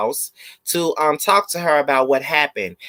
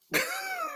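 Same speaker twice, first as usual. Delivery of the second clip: high squeal laugh; 14 dB quieter than usual. Real, not acted.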